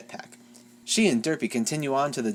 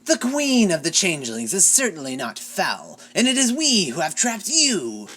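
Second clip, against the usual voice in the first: gloating queen; 5 semitones higher, 3 dB louder than usual. A narrator's reading.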